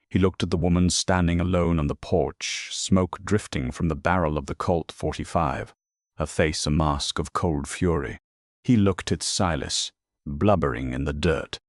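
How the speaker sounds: usual speaking voice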